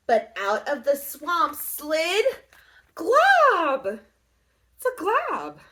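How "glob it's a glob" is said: blob creature voice. A narrator's reading. Glob creature voice.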